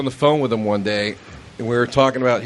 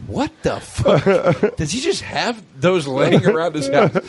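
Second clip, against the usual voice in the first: baffled laugh; 6 semitones higher, slower than usual. Retold or imitated.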